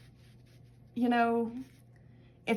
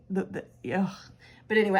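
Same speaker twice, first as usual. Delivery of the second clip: usual speaking voice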